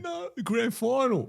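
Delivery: giggling through the words; can't get it out for laughing. Real, not acted. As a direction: laugh it out, high. Laughing recalling the grand final.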